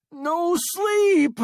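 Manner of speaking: very high, loud scream. A narrator's reading. Stranded man refusing sleep, panicked scream. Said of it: "screamed Sale".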